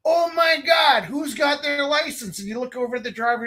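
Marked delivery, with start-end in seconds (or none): 0.1-2.4 high, slow excited shout; half-shouted teenage excitement. Retold or imitated. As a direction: yell it like a giddy kid in the back seat. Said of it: "you're like".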